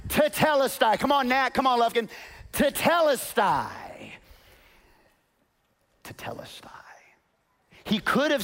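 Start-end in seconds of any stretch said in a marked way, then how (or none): 0.1-3.7 rally the crowd to say it; congregation call. Shown as a demonstration. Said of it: "Everybody say it with me". 6.0-6.7 whispered tetelestai. Shown as a demonstration.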